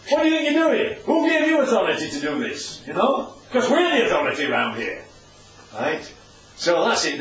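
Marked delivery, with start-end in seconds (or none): 0.1-5.0 higher-pitched, indignant challenge; shirty. Acted. Temple leaders having a go at Jesus, very shirtily. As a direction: act out affronted officials pulling rank. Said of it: "very shirtily, they say".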